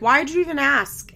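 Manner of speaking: mildly exasperated retort; mock pissed-off question. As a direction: snap it back like a fed-up mom. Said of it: "Janelle's, like, pissed".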